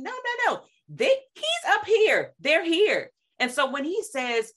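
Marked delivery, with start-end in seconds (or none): 0.0-3.1 slow, lifted he's up here; emphatic insistence. Real, not acted. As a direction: pitch leaps way up on no, no. Emphatic contrast, God above.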